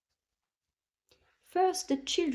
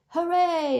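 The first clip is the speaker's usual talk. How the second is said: bright high cheer; a happy kids' cheer; 8 semitones higher, 5 dB louder than usual. A narrator's reading. Narrator voicing the children cheering at playgroup. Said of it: "everyone cheered".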